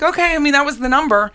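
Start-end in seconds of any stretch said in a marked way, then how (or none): 0.0-0.3 high, surprised "Okay"; a quick shocked acceptance; startled okay. Retold or imitated. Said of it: "But I was like".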